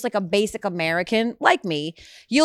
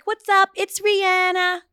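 high, drawn-out radio drop greeting; sing-out intro, stretching the name; 12 semitones higher, 3 dB louder, slower than usual. Retold or imitated. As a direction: imitate a star's radio drop, pitched way up. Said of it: "And she's like".

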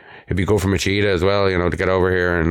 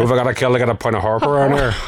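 put-on quick Northern Irish accent, pitched up; 5 semitones higher, much faster than usual. Demanding a pint at the bar in a Northern Irish accent. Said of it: "Give us an Australian accent".

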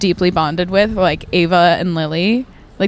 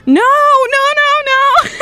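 much higher, squealing no no no; panicked denial; 5 dB louder than usual. Retold or imitated. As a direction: shriek the refusal in mock panic. Retold panicked refusal.

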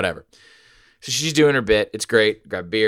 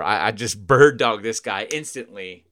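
ordinary delivery

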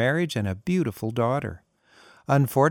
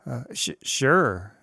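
stuttering; slower than usual. A narrator's reading. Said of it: "John stuttered".

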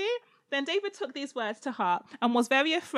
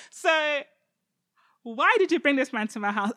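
ordinary talk

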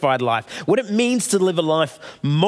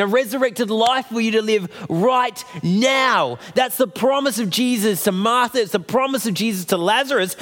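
full-force preaching; 5 semitones higher than usual. Acted. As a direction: preach it out, rising with conviction.

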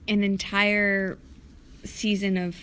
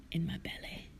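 conspiratorial whisper; 3 semitones lower, 10 dB quieter than usual. Real, not acted. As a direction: whisper it cheekily. Whispered joke.

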